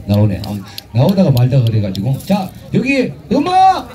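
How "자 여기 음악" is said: high, drawn-out call for music; stretched, high cry of 음악; calling out cue. Acted. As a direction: sing out the cue to the band. Cueing the band.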